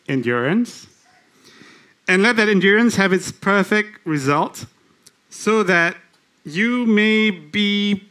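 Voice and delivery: slow scripture reading with long, weighted pauses. Real, not acted. Preacher reading the verse to show the congregation the problem, very slowly. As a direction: draw out each phrase and let it hang. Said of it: "I'm gonna read it very slowly".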